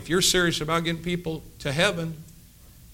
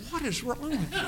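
puzzled stare; 10 semitones higher, 10 dB quieter than usual. Retold or imitated. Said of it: "this guy's looking at him like".

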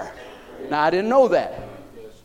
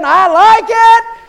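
much higher, louder, slow and beaming; delighted Jesus; 14 dB louder, much slower than usual. Retold or imitated. Preacher voicing Jesus delighting in his singing, beaming. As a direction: relish every word like pure joy. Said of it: "Guess what he said?".

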